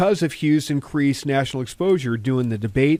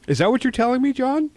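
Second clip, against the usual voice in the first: rising, higher teasing question; teasing rise; 9 semitones higher than usual. Retold or imitated. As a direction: needle him with a knowing lilt. Teasing his reluctant friend.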